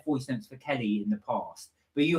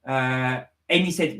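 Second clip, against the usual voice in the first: ordinary talk, nothing marked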